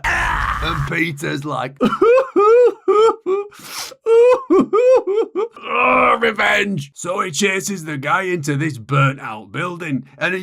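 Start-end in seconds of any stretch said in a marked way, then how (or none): 1.8-5.6 long high wailing scream of grief; anguished cry. Retold or imitated. 5.7-6.9 high, loud cry of revenge; hammed-up vow. Peter vows revenge.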